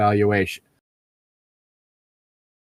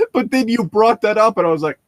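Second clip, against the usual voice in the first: usual speaking voice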